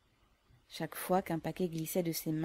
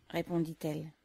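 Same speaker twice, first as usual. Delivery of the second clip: plain reading voice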